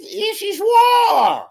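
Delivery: slow, drawn-out high shout; hammed-up slow war shout; mock war cry. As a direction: bellow it like a battle cry. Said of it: "I don't know if I'm James Carville".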